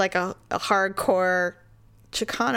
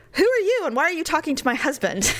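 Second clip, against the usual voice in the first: higher-pitched retold challenge, light rather than hostile; friendly aggressive; 7 semitones higher than usual. Wife confronts a woman talking to her husband, friendly aggressive. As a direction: imitate a wife half-playfully demanding answers. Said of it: "she was being friendly aggressive".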